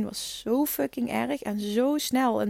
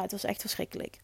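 dropped to a near whisper; hushed confession of the pain; 11 dB quieter than usual. Real, not acted. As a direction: breathe it out, almost voiceless. Retelling painful labour.

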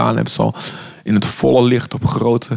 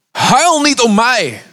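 much higher, louder and slow, pleading "Huil" drawn out; anguished plea; 12 semitones higher, 4 dB louder, slower than usual. Acted. Jesus under the cross turning to the weeping women, anguished slow plea. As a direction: cry out as a suffering Jesus.

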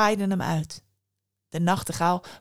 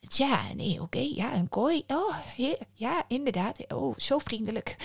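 hesitant and quiet; 4 semitones higher, 4 dB quieter than usual. A narrator's reading. Bird hesitates, sees the cage.

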